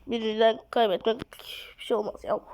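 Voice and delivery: usual speaking voice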